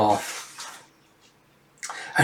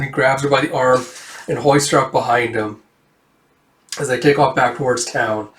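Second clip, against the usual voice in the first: usual speaking voice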